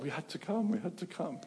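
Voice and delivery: as though compelled and puzzled; mock bewildered. People explaining why they came.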